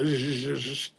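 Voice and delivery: mock Cuban Spanish slur "sh-, sh-"; imitating Cuban slurred sh. Mocking how Cubans in Miami slur their Spanish. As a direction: hiss out "sh-" like a Cuban speaker. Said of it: "they're all like".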